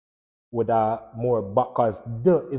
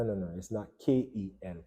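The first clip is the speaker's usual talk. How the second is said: ordinary talk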